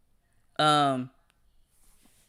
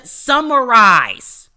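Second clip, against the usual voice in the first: "summarize" loud and drawn out slowly; emphatic instruction; 4 semitones higher, 9 dB louder, much slower than usual. Real, not acted. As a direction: stretch "summarize" and hit it hard. Stressing his key advice word.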